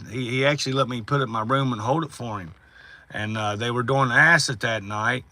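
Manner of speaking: ordinary delivery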